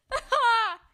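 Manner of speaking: high burst of laughter; loud laugh. Real, not acted. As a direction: explode into laughter. Cracking up at the cat.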